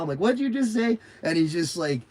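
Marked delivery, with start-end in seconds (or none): 0.2-1.0 high, louder mock-innocent question; grinning challenge. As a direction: ask it through a huge smile to wind him up. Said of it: "with a huge smile".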